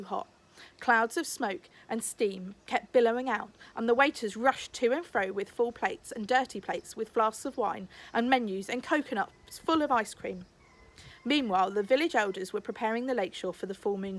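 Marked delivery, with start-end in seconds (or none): none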